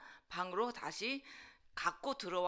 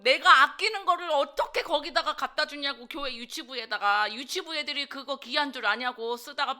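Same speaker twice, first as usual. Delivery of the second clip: loud, raised retort at mom; angry protest; 4 semitones higher, 7 dB louder than usual. Retold or imitated. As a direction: re-enact an indignant outburst. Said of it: "엄마한테 대들고 화를 내고".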